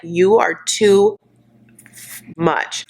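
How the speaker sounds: TOO stretched out, long pause before "much"; emphatic telling-off. Acted. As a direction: draw out "too", pause, then drop "much". Telling off imagined partner.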